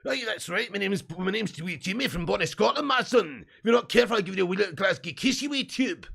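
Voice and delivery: hammed-up comic Scottish accent. Streamer obliging a viewer, mock Scottish accent. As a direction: play a boisterous Scotsman, thick put-on accent. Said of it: "Do a Scotland accent".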